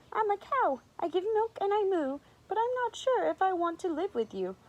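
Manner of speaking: high, worried cow voice; lightly acted nervous storybook cow; timid cow. A narrator's reading. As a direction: pitch it high, unsure and nervous. Cow timid before the lions.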